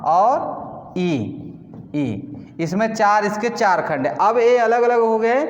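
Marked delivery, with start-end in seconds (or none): 0.0-1.1 "ई" stretched very long and slow; drawn-out ee. Shown as a demonstration. Teacher isolating the vowel "ई" for the class, slowly. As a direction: hold the "ई" sound out for students. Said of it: "न".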